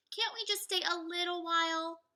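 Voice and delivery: pleading Annie. Acted.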